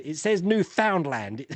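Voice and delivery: Newfoundland read slowly as new-FOUND-land; slow, spelt-out Newfoundland, new found land; spelling pronunciation. Retold or imitated. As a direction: insist on the full "found" in Newfoundland. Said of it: "You spell it".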